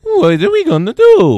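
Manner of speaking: loud, high cartoon Italian whine; over-the-top high Italian accent; cartoony Italian accent. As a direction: wail it like a cartoon Italian plumber. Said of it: "obviously not that cartoony".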